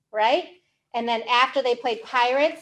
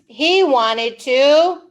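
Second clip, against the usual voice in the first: drawn out, louder build-up; slow suspense; 5 dB louder, much slower than usual. Acted. Reader building suspense before the monster's wish. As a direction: stretch it to tease the listener.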